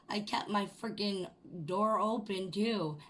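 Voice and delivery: ordinary talk, nothing marked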